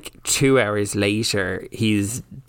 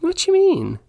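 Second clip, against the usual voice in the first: mock offense; 4 dB louder than usual.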